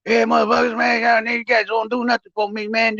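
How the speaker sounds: imitating a raving patient; retold hollering. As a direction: loose mock tirade at the nurses. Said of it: "That fool went in there hollering and raving".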